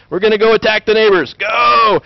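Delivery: rally the knights, hammed-up; mock battle cry. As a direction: knight rallying troops to attack, mock shout. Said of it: "the edge of military technology was".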